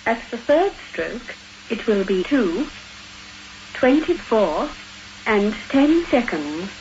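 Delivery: recorded speaking clock; choppy machine-assembled time call; spliced together words. Acted. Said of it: "speaking clock you heard before".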